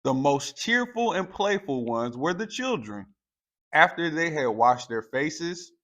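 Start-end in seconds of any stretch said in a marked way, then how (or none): none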